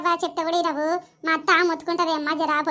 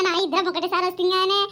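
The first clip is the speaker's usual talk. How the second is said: ordinary talk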